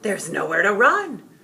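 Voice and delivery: shrill-high loud despairing cry; panicked reply. A narrator's reading. Another spectator answers in loud despair. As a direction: shriek hopelessly. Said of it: "another responded".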